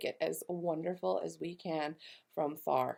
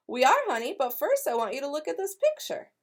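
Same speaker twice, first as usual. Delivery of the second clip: higher, louder loving granny; fond grandmother; 7 semitones higher, 5 dB louder than usual. Acted. Nana showing Grace the ballet poster, fond granny. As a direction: tease the surprise gently.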